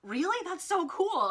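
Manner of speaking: high, loud excited burst; thrilled reaction. Retold or imitated. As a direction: imitate her genuine, impressed delight. Retells idol's thrilled reaction.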